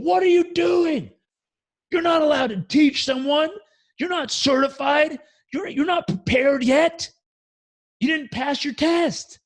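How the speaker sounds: high, slow, furious shout; giant roar. Retold or imitated. Master to the student teaching a kid, a furious roar. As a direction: roar it from the back of the room. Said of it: "you heard this giant roar".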